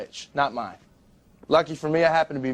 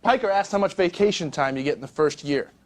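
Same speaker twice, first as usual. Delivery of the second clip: ordinary talk, nothing marked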